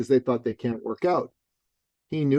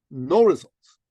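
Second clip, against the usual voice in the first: ordinary delivery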